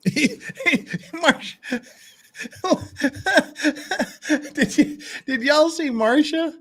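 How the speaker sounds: high, breaking up with laughter; trying to talk through real giggles; laughing. Real, not acted. Streamer cracking up at Marcia's reaction, laughing.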